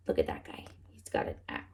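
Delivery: hushed whisper, as if the man might hear. Real, not acted. Secret aside about the axe man.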